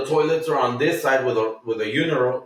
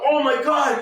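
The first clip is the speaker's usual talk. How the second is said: much higher gasped exclamation; shocked oh my God; 3 dB louder than usual. Retold or imitated. Danny hearing the news, high shocked exclamation. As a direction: react in disbelief. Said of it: "He's like".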